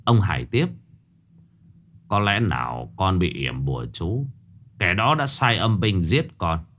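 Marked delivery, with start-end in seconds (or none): 2.1-6.7 stepfather gently asking his daughter about the ghost; grave stepfather. Acted. Stepfather Hải speaks.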